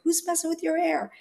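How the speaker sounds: a hint of a worried mother; mom asking. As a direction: play mom quizzing her daughter. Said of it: "And my mom would say".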